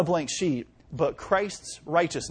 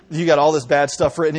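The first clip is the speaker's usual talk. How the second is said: ordinary talk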